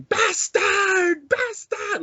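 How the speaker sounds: very high-pitched imitated scream; mock scream. Fan imitating a movie heroine's anguished screaming, very high. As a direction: scream it shrilly, imitating the actress. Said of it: "she's just screaming".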